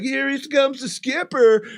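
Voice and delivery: high, celebratory entrance call; rousing announcement. Retold or imitated. Retold guest's grand champagne entrance.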